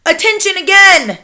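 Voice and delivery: high, loud call to order; shouted command. A narrator's reading. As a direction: bark it over the crowd. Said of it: "was shouting".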